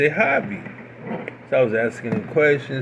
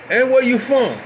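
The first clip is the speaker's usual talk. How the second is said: put-on North Carolina accent; 9 semitones higher, 3 dB louder than usual. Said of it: "deep North Carolina accent".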